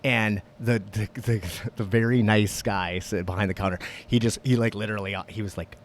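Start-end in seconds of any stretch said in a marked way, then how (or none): none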